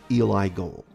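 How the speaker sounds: ordinary talk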